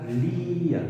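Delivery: Lía with a long, stressed í, Lí-a; Lía with the í held long and stressed. Shown as a demonstration. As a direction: stretch the í in Lía. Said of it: "¿Veis que yo la pronuncio más larga?".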